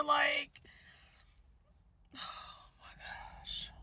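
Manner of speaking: breathy, hushed oh my gosh; exasperated whisper. Real, not acted. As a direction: whisper it in disbelief.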